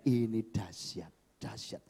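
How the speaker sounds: slow, low awed murmur; hushed awe. Retold or imitated. Rescued man praying in amazement, hushed awe. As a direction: drop low and slow in wonder, fading away.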